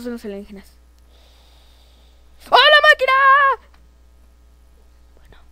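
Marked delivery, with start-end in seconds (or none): 2.5-3.1 very high, loud game panic; drawn high cry of alarm; alarmed shout. Pretend. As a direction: yell it in mock terror. Alarm at the machine.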